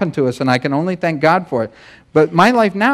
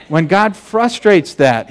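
ordinary talk, nothing marked

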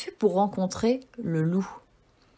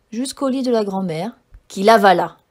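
ordinary delivery

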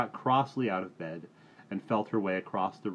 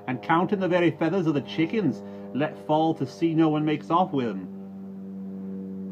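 pointed, higher, loud jibe, drawn out at the end; meaningful; 8 semitones higher, 8 dB louder than usual. Acted. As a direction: needle him with every word. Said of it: "said another voice, meaningfully".

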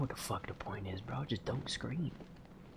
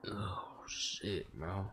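usual speaking voice

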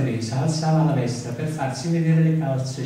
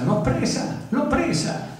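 put-on much higher, delighted girl's cry; little girl's cry; 12 semitones higher than usual. Teresa catching the butterfly, high delighted cry. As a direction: squeal it like a child catching a butterfly. Child's joyful shout in poem.

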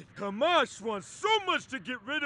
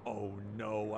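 ordinary talk, nothing marked

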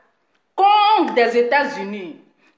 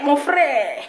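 ordinary talk

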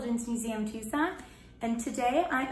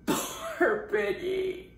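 mournful; 9 semitones higher, much slower than usual. Acted. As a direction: sigh it out sadly. Sad pity.

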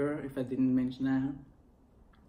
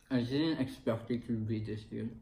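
chewing while talking; muffled by food in mouth; mouth full of fries. Real, not acted. Seen 0: Puts fries in mouth at 4.0; food visible while speaking after.

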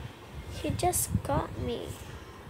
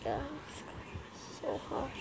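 whispering; 12 dB quieter than usual. Real, not acted. Frustrated gamer losing again.

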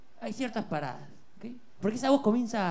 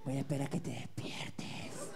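slow unvoiced whisper; whispering over a crib; 6 semitones lower, 9 dB quieter, slower than usual. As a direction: whisper to someone asleep. Whispering to sleeping partner.

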